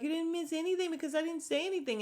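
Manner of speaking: high, fast imitation; mock defensive Candace. Vlogger imitating Candace brushing off Karen, high and fast. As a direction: rattle it off high like a defensive liar.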